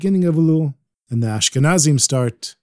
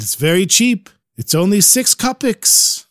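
put-on higher, coaxing Ukrainian accent; 7 semitones higher than usual. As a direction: persuade her in a Ukrainian accent. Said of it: "He speaks with a real Ukrainian accent".

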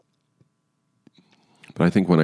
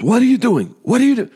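much higher, agitated mock yell; imitated yelling; 4 dB louder, faster than usual. Angry husband to driver who starts dialing, imitated yelling. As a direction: demand it in a high-pitched imitated shout. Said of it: "and he's yelling".

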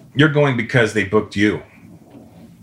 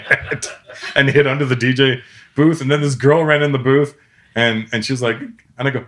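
normal talking voice

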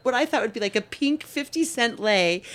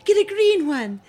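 daughter's demand; 11 semitones higher, 5 dB louder than usual. Retold or imitated. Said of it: "so she goes".

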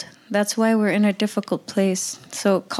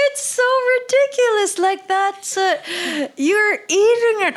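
much higher, cracking cry-laugh; laughing through tears. Real, not acted. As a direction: talk while laughing and half crying. Laughing and crying at the absurdity.

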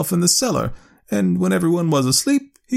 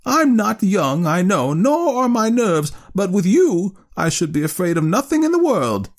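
put-on high, louder, scornful old aunt; 12 semitones higher, 4 dB louder than usual. A narrator's reading. Aunt dismissing her nephew's doubts, scornfully. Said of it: "she said scornfully".

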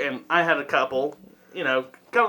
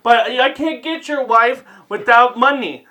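lightly faked African accent, higher-pitched; poor African accent; 6 semitones higher than usual. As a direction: protest in a rough African accent. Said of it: "In the poorest African accent".

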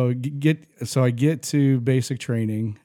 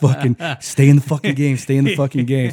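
louder, driving mock encouragement; urging drill sergeant; 2 semitones higher, 5 dB louder than usual. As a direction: push him to keep running. Sergeant urging him on during run.

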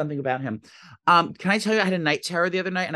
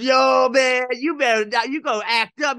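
louder, higher imitation of an excited bro; hyped-up store guy; 8 semitones higher, 4 dB louder, slower than usual. Grocery guy teasing a customer about tonight, hammed-up excitement. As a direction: egg on a buddy with loud mock hype.

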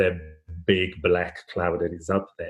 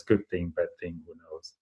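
calm shrug; 3 semitones lower, 7 dB quieter than usual. Retold or imitated. Farmer shrugs off the crooked leg.

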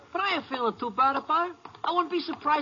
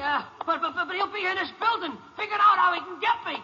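high, frantic fear, slowing to a yell; rising panic; 8 semitones higher than usual. Acted. Prisoner protests to the ranger about Foley, panicking. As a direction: spiral into terror.

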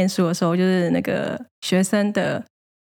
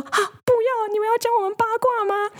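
very high, squeaky panicked plea; hammed-up frightened wail; mock terrified. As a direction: imitate a coworker dreading being gossiped about. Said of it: "一副真的是超害怕我们在八卦他们".

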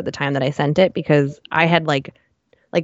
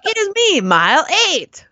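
put-on Italian accent; 14 semitones higher than usual. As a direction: ham up an Italian guy's accent, pitched high. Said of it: "like, Italian accent or something".

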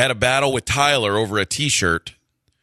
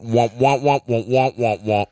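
Charlie Brown's teacher; slower than usual. Retold or imitated. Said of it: "sounded like Charlie Brown's teacher".

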